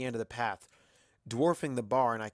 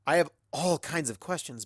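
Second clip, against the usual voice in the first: plain reading voice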